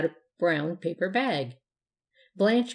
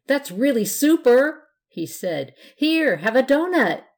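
put-on high, loud delighted kid; excited; 6 semitones higher, 7 dB louder than usual. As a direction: gush with delight. Ghost delighted, offering donut.

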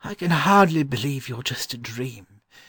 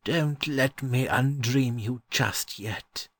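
put-on slow, higher old gentleman's voice; wistful kindness; 7 semitones higher, slower than usual. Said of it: "looking at them with wistful kindness".

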